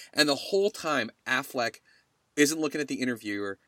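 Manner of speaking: usual speaking voice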